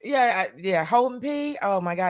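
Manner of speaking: normal talking voice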